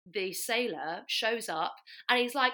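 ordinary delivery

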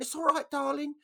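put-on very high old-lady voice; squeaky old woman calling out comfort; little old dear. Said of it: "this little old deer's head pops out".

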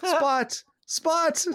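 rising, delighted calling of the cat's name; excited recognition. Retold or imitated. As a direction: call the name high, like spotting an old friend. Remembering the cat's surprise return.

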